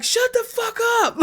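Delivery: yelled from a window; furious neighbor shouting; angry shout. Retold or imitated. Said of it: "somebody in the window was like".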